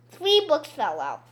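usual speaking voice

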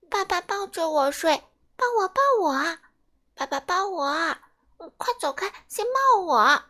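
pushy toddlers each begging to be held first; clamouring baby dinosaurs. Acted. Baby dinosaurs begging their dad to hold them, squeaky and pushy.

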